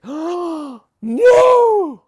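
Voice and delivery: hammed-up shocked "Oh, no!" climbing high and loud; gasp, then wail it out in fake horror; mock gasp. The whole house reacting to the bully accusation, hammed-up horrified shriek. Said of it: "And we're like".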